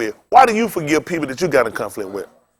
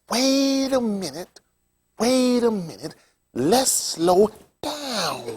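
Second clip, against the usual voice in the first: very slow, soft, higher-pitched imitation; slow-talking preacher; 4 semitones higher, 7 dB quieter, much slower than usual. Imitating the preacher who called him, very slow talk. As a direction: stretch every word out, softly mimicking him. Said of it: "He talked like that".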